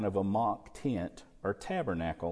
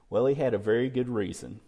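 ordinary delivery

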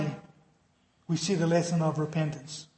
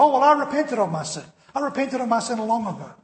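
smug dismissal; 5 semitones higher, 4 dB louder than usual. Retold or imitated.

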